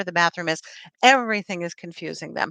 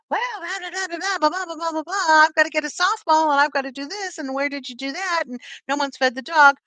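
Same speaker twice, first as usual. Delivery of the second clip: much higher, nagging sing-song blah blah; mocking family chatter; 8 semitones higher, faster than usual. The family swarming mom at the door, mock nagging chatter. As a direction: play the whole house talking at you at once. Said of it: "everybody's like".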